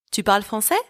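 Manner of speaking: yes-or-no question "Tu parles français", climbing at the end; rising question intonation. Shown as a demonstration. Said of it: "raise my voice at the end of that sentence".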